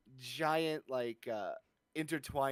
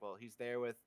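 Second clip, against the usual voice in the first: usual speaking voice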